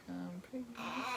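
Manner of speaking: very soft, slightly high confession; retold meek confession; sheepish admission. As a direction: own up in a small voice.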